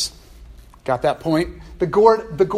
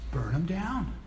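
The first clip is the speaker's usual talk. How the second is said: soft, matter-of-fact verdict; understated condemnation; 8 dB quieter than usual. Acted. Patriot Jonah to God about Nineveh, quietly dismissive. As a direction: say it quietly, as a settled conclusion.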